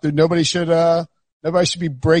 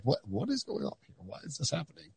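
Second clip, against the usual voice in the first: much softer, puzzled aside; mock bafflement; 17 dB quieter than usual. Imagined radio listeners hearing the accent, quietly mock-baffled. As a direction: imitate baffled listeners under your breath.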